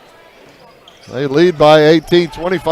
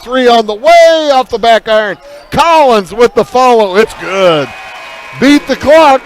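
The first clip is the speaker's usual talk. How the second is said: excited call; 6 semitones higher than usual. Real, not acted. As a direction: sell the shot beating the clock. Buzzer-beater three at quarter end.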